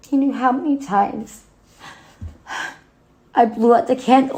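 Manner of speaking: loud, high, pained pleading; anguished outburst. Acted. Daughter telling dead mother of wasted birthday wishes, anguished outburst.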